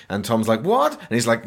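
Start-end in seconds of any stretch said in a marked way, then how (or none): 0.6-0.9 very high squeaked question; comically baffled yelp; mock shock. Tom told he is cursed, mock shock.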